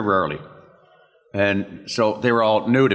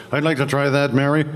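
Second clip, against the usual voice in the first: higher, put-on sitcom voice; Ted Knight; 5 semitones higher than usual. Colleague volunteering for the impression part, hammy voice. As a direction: project it as a hammy TV actor. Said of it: "his Ted Knight voice".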